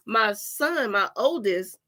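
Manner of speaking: ordinary delivery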